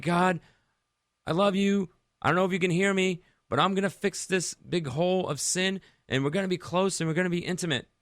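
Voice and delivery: high, loud, fast cry up to God; yelling at God. Retold or imitated. A believer yells his promise at God. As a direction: shout it up to heaven, desperate. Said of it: "I'm yelling at God".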